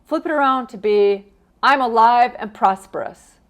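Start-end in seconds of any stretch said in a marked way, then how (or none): 1.6-3.4 loud, confident mantra; enthusiastic affirmation. Shown as a demonstration. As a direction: declare it proudly. Models the upbeat replacement mantra.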